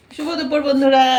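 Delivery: bright loud hello to viewers; cheerful greeting. Real, not acted. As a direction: greet the audience with a burst.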